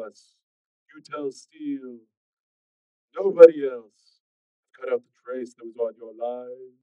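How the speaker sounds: slow, hollow kidnapper on the line; sinister hollow-voiced caller; coarse, hollow phone voice. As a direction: draw out the threats in a hollow drone. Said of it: "the coarse and hollow-sounding voice".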